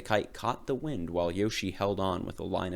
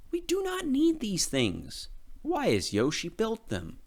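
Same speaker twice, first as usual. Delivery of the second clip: angry; 13 semitones higher than usual. A narrator's reading. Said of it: "they said in anger".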